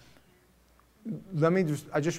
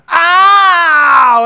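very high, loud yelp of pain, played by a parent; a child's sudden pained "Ow!"; kid crying out; 19 dB louder than usual. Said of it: "And they go".

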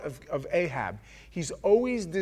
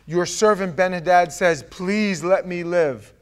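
loud, higher plea, slowing on let me live; pleading servants; 4 semitones higher, 8 dB louder, slower than usual. Acted. Servants beg the king of Israel, loud drawn-out plea. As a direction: beg the king for your master's life.

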